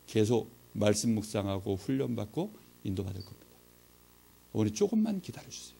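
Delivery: hushed, sincere retold plea; earnest plea. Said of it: "진지하게 말씀을 드렸어요".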